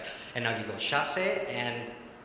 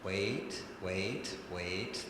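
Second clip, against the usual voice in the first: softer, lower, stretched waits; soft drawn waits; 5 semitones lower, 8 dB quieter, much slower than usual. Shown as a demonstration. Coach holding the dancer back from landing, soft and drawn out. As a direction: hold them back gently. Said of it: "wait until a little bit longer".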